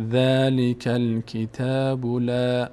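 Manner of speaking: level, drawn-out syllables with long vowels; slow model reading. Shown as a demonstration. Teacher reading the phrase so far, slow measured recitation. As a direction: recite slowly on an even pitch.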